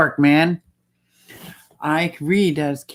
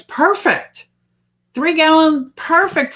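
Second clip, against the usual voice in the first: eager yes three gallon; excited approval; 10 semitones higher, slower than usual. Real, not acted. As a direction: cheer the idea on. Excited approval of small tank.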